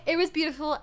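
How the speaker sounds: embarrassed laughing. Real, not acted. Embarrassed about date questions.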